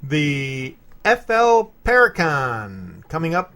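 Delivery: loud, drawn-out segment intro; news announcer. Pretend. Host doing the news thing for listeners, loud announcer read. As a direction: announce it big, stretching the event name. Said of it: "You gotta do the news thing".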